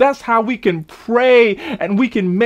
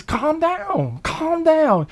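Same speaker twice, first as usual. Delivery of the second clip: soothing calm down; 8 semitones higher, much slower than usual. Shown as a demonstration. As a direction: settle her down gently. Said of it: "It, it means".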